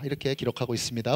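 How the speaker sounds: normal talking voice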